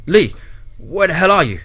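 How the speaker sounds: loud, high yell into a phone; frantic yell. A narrator's reading. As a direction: yell in a frenzy for an answer. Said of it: "he yelled".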